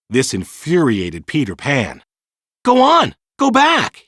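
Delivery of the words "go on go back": high, loud angry outburst. A narrator's reading. Peter furious at Wendy for missing home, loud outburst. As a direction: snap at her to go. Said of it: "he said".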